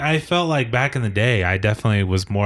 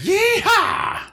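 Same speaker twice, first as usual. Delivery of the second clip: high cowboy holler; yeehaw whoop. Acted.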